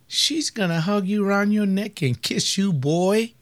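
ordinary talk

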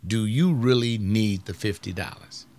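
normal talking voice

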